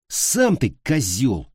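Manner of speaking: plain reading voice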